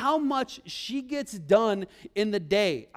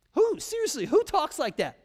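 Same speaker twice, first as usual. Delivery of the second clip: incredulous; 6 semitones higher than usual. Real, not acted. Mocks the stilted question.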